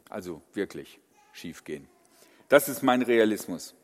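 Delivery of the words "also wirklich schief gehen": low mutter. Real, not acted. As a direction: drop to a low, soft mutter. Pessimist repeats, voice drops low.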